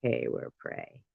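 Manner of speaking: low, slow, soft cat voice; deadpan cat voice, low and soft. Retold or imitated. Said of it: "I think a cat would just say".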